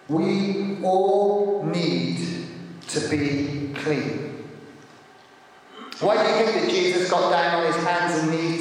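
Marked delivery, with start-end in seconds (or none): 0.1-4.4 slow emphasis. Shown as a demonstration. Preacher to the congregation, very slow emphatic repeat. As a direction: let it sink in slowly. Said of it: "repeated for emphasis".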